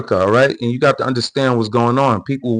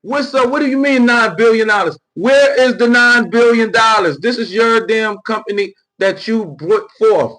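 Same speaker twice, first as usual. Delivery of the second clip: mock confrontation; 9 semitones higher than usual. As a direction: get right in her face and demand answers. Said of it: "stick the mic in her face like".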